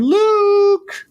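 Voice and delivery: high, loud call into the distance; imitating aunt and uncle calling out; calling for Luke. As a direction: shout his name across the desert. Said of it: "Owen and Beru are out there going".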